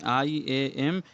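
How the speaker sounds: letters spelled out slowly one by one; teacher slowly spelling iambic. Shown as a demonstration. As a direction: spell it out clearly for students. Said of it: "iambic कहते हैं".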